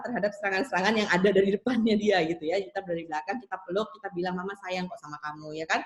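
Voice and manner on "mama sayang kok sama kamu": put-on softer, tender reassurance to a child; soothing mother. Mother hugging her angry child from behind, soft and tender. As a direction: hush it gently while holding the child.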